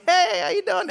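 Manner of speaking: high, falsely bright hello; mock two-faced friendliness; fake cheery greeting. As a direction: greet them sweetly before the backstab.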